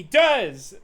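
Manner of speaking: loud burst on the second word; sudden loud protest; emphatic outburst. Acted. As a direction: blurt it out forcefully. Acted outburst reading game dialogue.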